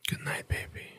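soft sleepy whisper. Real, not acted. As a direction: breathe it as they drift off. Cuddling as big spoon, sleep.

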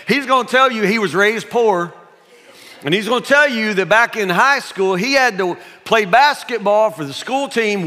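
high, loud preacher's build; fired-up preaching. Acted. Pastor urging the congregation to test his dad, loud preaching.

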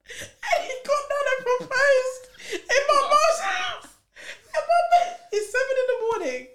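high, loud laugh-cry; helpless laughing disbelief. Real, not acted. Telling friends about the proposal in mum's house, shrieking with laughter. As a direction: wail it out through laughter.

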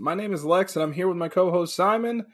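high-pitched, sing-out show welcome; podcast host intro. Acted. Host welcomes listeners and co-host Simon, high announcer-style greeting.

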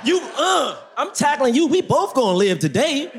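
high-pitched heroic shouting-out; acted urgent rescue. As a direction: act out tackling someone to safety. Acts out a movie rescue.

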